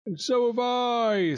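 high, long drawn-out sigh; exhausted sighing complaint. A narrator's reading. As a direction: sigh it out slowly, pitched high. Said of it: "sighed Swine".

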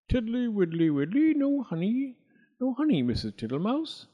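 a greedy toad guest, all fawning thanks; high singsong toad; Mister Jackson the toad. Acted. Toad searches pantry.

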